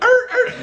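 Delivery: very high, loud laughing yelps; mock frightened yelps. Hikers reacting to cannibal joke, hammed-up yelps. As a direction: squeal like scared kids, hammed-up. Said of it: "We're like".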